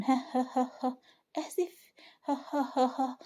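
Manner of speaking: unhinged, high staccato "ha-ha"; acted crazed laugh, breaking round the word; mad laughter. A narrator's reading. Wife scoffing at her husband's apology, laughing madly. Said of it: "ملاك بضحك وجنون".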